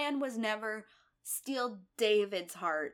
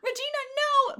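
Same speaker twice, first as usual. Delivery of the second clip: mock cry out; 9 dB louder, much faster than usual. Mock calling out to Regina.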